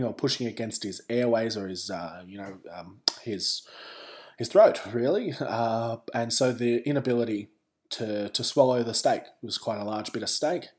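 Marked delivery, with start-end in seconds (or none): none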